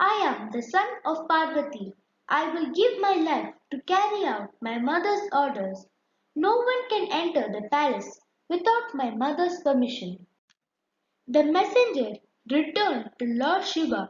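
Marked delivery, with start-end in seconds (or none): 0.1-10.1 put-on quick, firm and proud, a boy guarding the door; firm declaration. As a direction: rattle it off fast like a loyal young guard. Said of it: "He declared".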